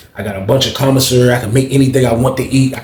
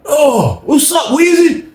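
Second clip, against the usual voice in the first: calling out; much slower than usual. Retold or imitated. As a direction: shout it slow across the pod. [name] calls out through the door.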